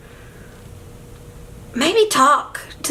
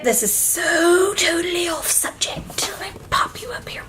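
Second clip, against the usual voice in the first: stretched-out confiding whisper; airy, conspiratorial whisper; whispered aside; 6 semitones higher, 4 dB quieter than usual. Real, not acted. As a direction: whisper it like an off-topic secret.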